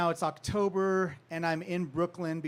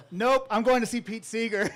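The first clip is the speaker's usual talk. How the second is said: louder, eager brush-off; excited; 4 semitones higher, 8 dB louder than usual. Retold or imitated. Ditching the book signing to meet [name], gleeful. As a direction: toss the duties aside gleefully.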